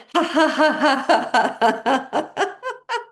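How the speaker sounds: long high burst of forced laughter; drawn-out exercise laugh on one breath; fake laughter. Shown as a demonstration. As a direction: let the held breath out in faked ha-has. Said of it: "See how many ha, ha sounds".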